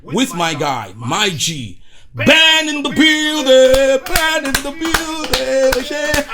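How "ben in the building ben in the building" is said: drawn-out, high, loud hype call; hype announcer. Acted. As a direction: stretch it out like a ring announcer. Hyping guest like an announcer.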